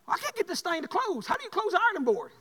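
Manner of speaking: high-pitched mock frustration; exasperated daughter. Daughter struggling with the ironing board, imitated exasperation. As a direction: imitate a daughter at her wits' end.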